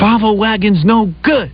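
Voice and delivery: slow, high mock protest; drawn-out, high-pitched wife imitation; imitating yelling wife. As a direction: mimic a wife's complaint, no real shouting. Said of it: "she'll start yelling at me".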